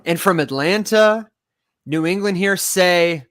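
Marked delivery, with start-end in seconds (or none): none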